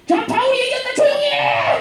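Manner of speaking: very high, piercing mock yell; heckling yell. Slave girl interrupts Paul's preaching, heckling yell. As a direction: yell over the preacher to drown him out.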